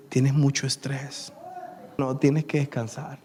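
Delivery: doctor calmly reassuring his patient. Retold or imitated. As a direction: settle the patient down gently. Doctor calmly explains the diagnosis.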